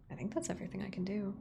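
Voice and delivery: quick soft whisper. Real, not acted. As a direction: rush it under your breath. Fast whispered aside.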